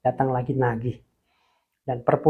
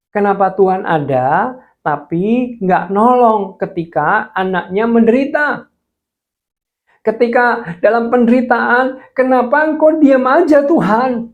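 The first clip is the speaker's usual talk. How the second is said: loud, high, pleading accusation; anguished outcry; 8 semitones higher, 6 dB louder than usual. Retold or imitated. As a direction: demand why God stays silent. Crying out at God in suffering. (seruan pilu menuntut Tuhan)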